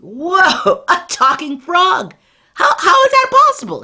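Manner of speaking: loud, high cry of wonder; amazed exclamation. Acted. Old man astonished by a talking frog, loud amazed cry. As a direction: blurt it out, astonished.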